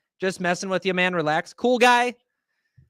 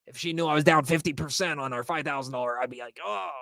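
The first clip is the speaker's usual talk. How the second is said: panicked investor; 7 semitones lower, 4 dB quieter than usual. Retold or imitated. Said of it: "You're like".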